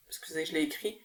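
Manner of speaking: quick unvoiced whisper, half to herself; whispered aside. Real, not acted. Vlogger whispering to herself while reading the artist's name. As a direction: breathe it as an apology while checking her notes.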